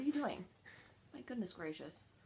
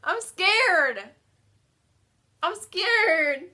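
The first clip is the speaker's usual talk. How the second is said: higher, louder frightened whine; scared whine; 9 semitones higher, 9 dB louder, much slower than usual. Real, not acted. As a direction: whine it in dread. Dreading the spoiled milk bean.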